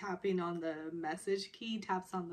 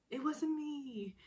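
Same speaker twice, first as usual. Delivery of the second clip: high, slow mock denial; teasing echo; 7 semitones higher, slower than usual. Man repeating her denial to tease her, teasing echo. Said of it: "he said it in this way".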